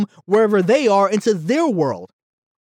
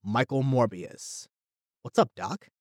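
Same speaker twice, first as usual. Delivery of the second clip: low, soft, slowed cool delivery; low quoted line; 6 semitones lower, 8 dB quieter than usual. Retold or imitated. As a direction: drop low and quiet like a trailer sting. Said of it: "they go".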